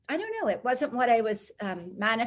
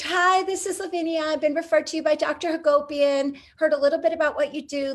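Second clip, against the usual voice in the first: higher, louder voicemail voice; leaving a message; 6 semitones higher, 5 dB louder than usual. Retold or imitated. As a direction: re-enact an upbeat phone introduction. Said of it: "so I leave the message".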